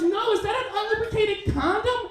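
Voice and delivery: very high-pitched incredulous question; high imitation of an appalled date; alarmed woman. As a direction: put on a high voice for a worried woman.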